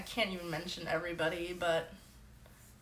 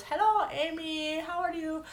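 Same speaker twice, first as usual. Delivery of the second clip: put-on mom's Persian accent; 10 semitones higher, 4 dB louder than usual. Mom trying to chat with a famous singer, cartoonish Persian accent. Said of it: "making a weird impression".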